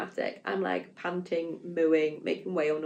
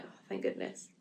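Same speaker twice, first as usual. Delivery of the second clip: breathy whispered relief; a hushed thank goodness; relieved whisper; 13 semitones lower, 12 dB quieter than usual. Retold or imitated. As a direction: sigh it out under your breath, relieved. Relieved someone will examine her.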